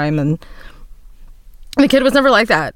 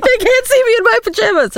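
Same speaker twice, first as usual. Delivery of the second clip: squeaky-high imitation of an upset kid; worked-up child. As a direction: mimic a little kid fretting, very high pitched. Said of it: "He gets, like, really worked up about it".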